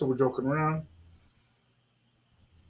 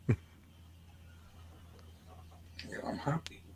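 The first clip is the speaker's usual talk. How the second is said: whisper; 21 dB quieter, faster than usual. Retold or imitated. Retold soft reply to friend.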